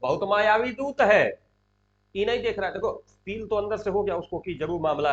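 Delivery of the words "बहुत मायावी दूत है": slow, drawn-out raised exclamation; projected, theatrical outcry; Duryodhana crying out. Acted. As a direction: stretch the "oho" and proclaim it. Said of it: "कह रहा चिल्ला के".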